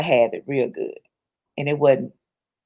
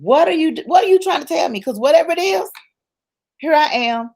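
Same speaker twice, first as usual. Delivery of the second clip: high, insistent questioning; exasperated high-pitched appeal to God; pleading with God; 10 semitones higher than usual. Acted. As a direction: plead upward for an answer. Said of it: "you're at the point like, God".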